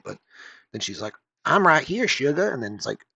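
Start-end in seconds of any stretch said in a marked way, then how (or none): 1.5-2.5 high, loud Southern belle. Acted. Talking gun answering its owner, hammed-up Southern belle. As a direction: put on a sugary Southern belle accent. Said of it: "talks like a Southern belle".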